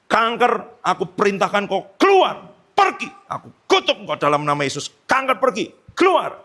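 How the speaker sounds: high, punched healing rebuke; forceful command. Retold or imitated. Evangelist orders cancer out of a patient, forceful high command. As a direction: order it out, pitch leaping on key words.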